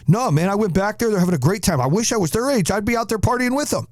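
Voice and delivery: high-pitched, easygoing cop voice; imitated cop. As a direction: play the cop who's cheering the party on.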